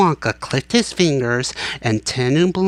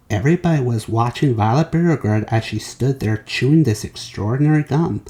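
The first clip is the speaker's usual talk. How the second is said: usual speaking voice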